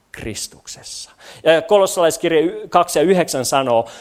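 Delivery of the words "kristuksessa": soft, low awe; hushed reverence. Real, not acted. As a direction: drop to an unvoiced hush. Reverent climax of quoted verse.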